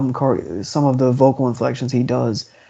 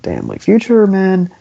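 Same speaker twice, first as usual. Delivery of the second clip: higher-pitched, admiring reaction; pitched-up, impressed aside; impressed exclamation; 7 semitones higher, 4 dB louder than usual. Acted. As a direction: voice a wowed listener's thought.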